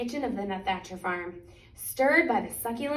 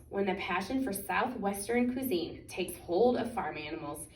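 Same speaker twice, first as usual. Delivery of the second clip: ordinary delivery, nothing marked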